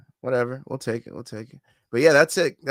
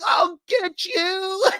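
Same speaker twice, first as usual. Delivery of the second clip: squeaky high mock battle cry; mocking a clueless fighter charging in, slow and high; mock noob yell; much slower than usual. Streamer mocking the fighter's reckless rush, high squeaky yell.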